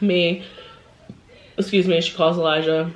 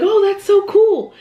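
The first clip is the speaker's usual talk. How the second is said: put-on much higher, louder, slow gushing child voice; excited little girl; 14 semitones higher, 6 dB louder than usual. Mom voicing the little girl, high and gushing. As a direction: squeal it like a thrilled little kid.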